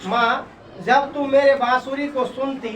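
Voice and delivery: usual speaking voice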